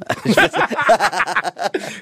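high giggling laugh after confessing; laughing at a silly admission; burst of high laughter. Real, not acted. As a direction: laugh out, embarrassed and amused. Laughing at his own confession.

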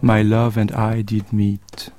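very slow, measured poem reading; poem recitation. Acted. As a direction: recite the verse slowly, lingering on each phrase. Reads Yeats poem aloud. (récitation lente d'un poème)

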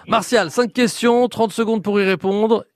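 bright, punchy quiz host launch; game show host. Acted. (animateur de jeu radio enjoué)

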